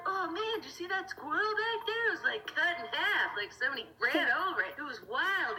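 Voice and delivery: breathless gossip about the squirrel; excited friend. Retold or imitated. Friends catching up at the bottom of the hill, excited gawking. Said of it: "they're like".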